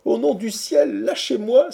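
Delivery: high, loud plea to be released; exclaiming. A narrator's reading. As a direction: cry out urgently in a high voice. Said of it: "s'écria Quatercam". (supplication criée, très aiguë)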